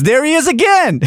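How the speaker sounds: high, gleeful tattling; excited. Retold or imitated. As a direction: squeal it with delight. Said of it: "he goes".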